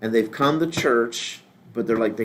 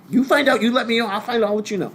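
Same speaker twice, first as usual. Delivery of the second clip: higher-pitched imitated back-and-forth; mock urgency; 8 semitones higher than usual. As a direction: replay the exchange in a raised voice. Replaying a back-and-forth exchange.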